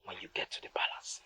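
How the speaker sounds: hushed conspiratorial whisper. Acted. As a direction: lean in and breathe it secretly.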